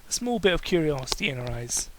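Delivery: ordinary delivery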